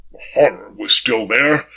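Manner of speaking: usual speaking voice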